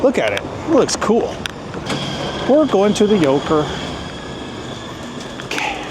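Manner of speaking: ordinary talk, nothing marked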